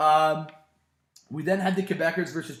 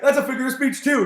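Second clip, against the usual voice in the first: laughing quote; 9 semitones higher, 8 dB louder than usual. Retold or imitated. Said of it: "he's like".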